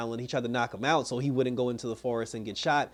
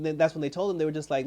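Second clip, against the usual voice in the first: usual speaking voice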